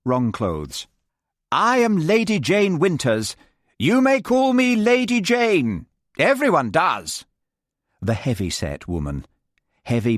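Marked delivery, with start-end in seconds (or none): 1.5-5.9 much higher and louder, grating and grand; high, loud Lady Jane. Acted. Lady Jane imposing her title on the group, high and loud. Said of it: "Her voice was high and loud". 6.2-7.3 higher and louder, falling with finality; haughty Lady Jane. Acted. Lady Jane dismissing the group, haughty falling tune. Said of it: "Her voice was high and loud".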